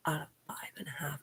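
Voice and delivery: quick breathy whisper aside. Real, not acted. Tallying years of doctor visits, a fast whispered aside.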